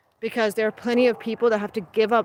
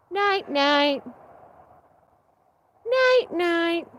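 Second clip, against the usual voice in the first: tucking in at bedtime; 7 semitones higher than usual. Real, not acted. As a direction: coo them gently to sleep. Talking goose to sleep.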